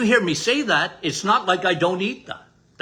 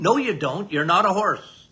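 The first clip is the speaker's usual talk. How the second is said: ordinary talk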